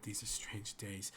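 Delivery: hushed, stunned guardsman; barely voiced, marvelling aside; awed whisper. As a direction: whisper it to yourself in wonder. Stunned guard reacting to strange party.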